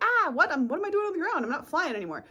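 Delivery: high, loud bewildered cry; a flustered bird who just hit the ground; mock confusion. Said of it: "you're stuck and you're like".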